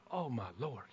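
very soft, low acted awe; barely voiced amazement; hushed awe. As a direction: murmur it as if overwhelmed. Acting awe after obeying God.

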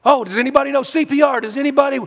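fast, high, loud cry for help; hammed-up frantic bystander; mock panic. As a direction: call out in pretend alarm, rushing. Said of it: "See, Jesus didn't go".

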